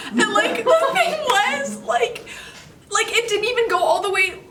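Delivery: high, squeaky, half-laughing confession; laughing distress. Real, not acted. Girl confessing she clipped a dragonfly, real laughing distress. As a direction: squeal it out through helpless laughter.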